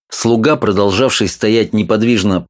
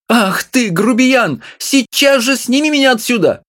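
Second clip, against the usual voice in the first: high, fussy little woman scolding; wife's voice. A narrator's reading. Small wife scolding her husband, high and fussy. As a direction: nag him in a high voice.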